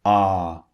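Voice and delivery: Ah with neutral mouth sides; long ah demo. Shown as a demonstration. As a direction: model the vowel Ah. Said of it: "They are neutral".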